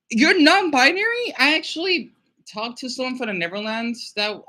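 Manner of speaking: ordinary delivery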